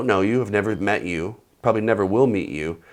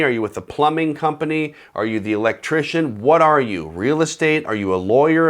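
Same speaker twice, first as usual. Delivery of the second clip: ordinary talk, nothing marked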